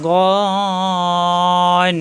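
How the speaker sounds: "গন" drawn out very long on one note; four-alif stretch. Shown as a demonstration. Teacher demonstrates letter length to pupils, very long stretch. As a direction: hold "গন" long and steady. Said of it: "চারালিপ পরিমাণ টান হবে".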